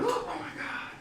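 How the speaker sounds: high, breathless whisper, slowing down; hushed mock-smitten schoolkid; awed crush. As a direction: gasp it softly in swooning admiration. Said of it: "and be like".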